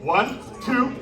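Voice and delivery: ordinary talk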